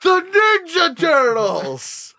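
high, stretched-out fan scream; re-enacted overjoyed holler; excited yelling. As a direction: yell it slowly, drawing out every word.

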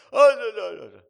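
sudden high yelled no no no; failed Stephen A impression. Mocking Stephen A on ESPN, a botched yelling impression. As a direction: blow up into a squeaky yell. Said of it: "That's not, that's not a Stephen A impression".

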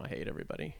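low, whispered "I hate everybody."; imitating Asuka. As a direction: whisper the bitter line, low. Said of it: "the final line of the show is".

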